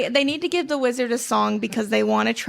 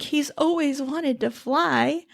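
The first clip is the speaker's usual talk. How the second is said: slow, sweet, syrupy voice; sugary fake sweetness; 4 semitones higher, slower than usual. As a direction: coo it deceptively. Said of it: "and being like".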